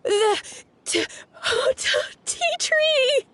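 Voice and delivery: stammering, high breathless gasp; stunned amazement. Real, not acted. Girl finding her seedling grown into a tree, stammering amazement. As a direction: trip over the words in disbelief.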